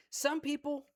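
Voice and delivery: ordinary talk, nothing marked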